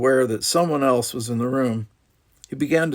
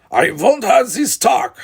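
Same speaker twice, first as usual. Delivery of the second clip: much higher, louder commanding shout; stern shout; 13 semitones higher, 10 dB louder than usual. Acted. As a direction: slam the argument shut. Father cuts off quarrel.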